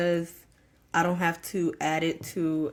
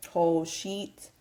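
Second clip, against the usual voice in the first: ordinary talk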